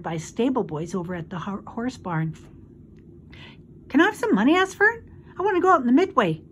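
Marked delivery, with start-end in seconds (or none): 3.9-5.0 put-on high, loud child voice; high-pitched kid request; girl pleading. A narrator's reading. Girl begs mother for money. 5.4-6.4 put-on fast, high, loud child voice; high-pitched quick kid request; girl pleading. A narrator's reading. Girl begs mother for money.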